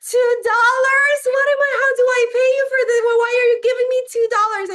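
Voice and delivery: very high, loud, sing-song disbelief; amazed child getting money; shocked kid. Retold or imitated. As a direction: shriek it like a stunned child. Kid shocked at two dollars.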